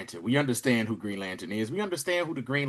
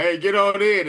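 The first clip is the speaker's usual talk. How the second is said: raised, higher-pitched holler; calling out; 8 semitones higher, 6 dB louder than usual. Retold or imitated. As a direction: call someone over to the car, louder. Acted call from the car.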